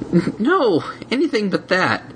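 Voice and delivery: high, stammering plea; terrified little dragon; dread. Acted. Spike dread.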